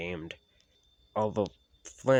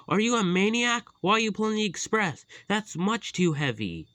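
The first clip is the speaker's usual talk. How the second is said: very high, loud, fast rebuke; alarmed scolding; 12 semitones higher, 6 dB louder than usual. Acted. James scolding Flynn, alarmed. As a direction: tell him off in disbelief.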